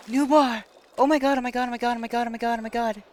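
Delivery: loud, high oh-my-god chant; excited. Real, not acted. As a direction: rattle it off, fading down from thrilled. Big fish catch on the line.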